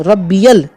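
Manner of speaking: "रब्बियल" said alone with the "ई-ए" clear; correct word alone. Shown as a demonstration. Teacher drilling the single word "रब्बियल". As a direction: isolate "रब्बियल", stretching the "ई-ए". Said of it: "रब्बिल नहीं है".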